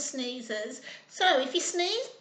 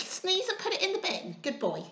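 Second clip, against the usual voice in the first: plain reading voice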